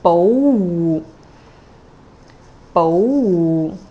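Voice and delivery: bo rising, wu low; rising then low tone. Shown as a demonstration. Teacher models bo wu, rising then low. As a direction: lift bo, drop to a low wu. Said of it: "One more".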